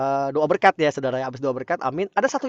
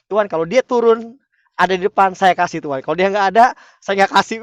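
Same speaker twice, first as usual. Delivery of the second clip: loud, raised-pitch retold plea to God; mock bargaining prayer; 5 semitones higher, 8 dB louder than usual. Preacher retells setting God a condition, mock bargaining prayer. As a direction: drive a hard bargain, playing up your former self.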